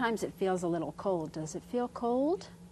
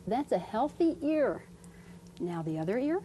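ordinary delivery

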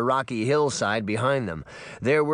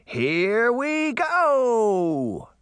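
drawn-out, high, loud yell; excited shout; 10 semitones higher, 4 dB louder, much slower than usual. A narrator's reading. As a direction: stretch it out as you launch. Puck launching down the hill.

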